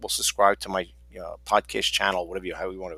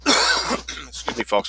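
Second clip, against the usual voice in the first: after a cough; much slower than usual. Real, not acted. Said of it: "I'm trying to get over a cold here".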